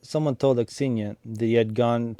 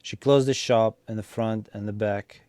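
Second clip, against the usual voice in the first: usual speaking voice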